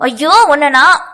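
high, loud sputtering outburst; shouted, breaking-off anger; furious panicked cry. A narrator's reading. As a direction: explode in angry panic. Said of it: "அதிக கோபத்துடன் பதறியவாறு".

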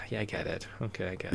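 very soft, fed-up muttering; weary acknowledgement. Acted. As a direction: mutter it under your breath, like a worn-down listener. Acted weary reply to whiny talk.

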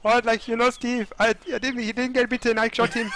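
high, hammed-up character voice; mock confession in a high cartoon voice; silly roleplay voice. Shown as a demonstration. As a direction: put on a goofy high voice to show why he won't. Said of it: "I'm not in the voices".

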